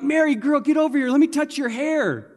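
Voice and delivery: high, loud, eager calling over; hammed-up high-pitched enthusiasm; mock excitement. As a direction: imitate an excited friend beckoning her close. Voices onlookers eager for the perfume.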